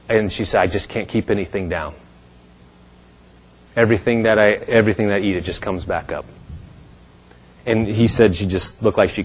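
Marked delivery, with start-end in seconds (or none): 0.6-6.2 soft, low, failing voice; weak, imitated. Sick woman explaining she can't eat, weak hushed voice. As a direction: imitate a patient with little strength. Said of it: "she was just whispering".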